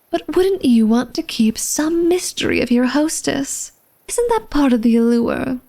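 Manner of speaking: normal talking voice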